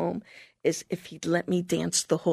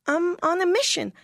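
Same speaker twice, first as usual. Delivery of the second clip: higher, earnest protest; pleading with mom; 10 semitones higher than usual. Retold or imitated. Young missionary refusing her mother's call, higher and earnest. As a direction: insist to your mother, higher. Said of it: "And I said".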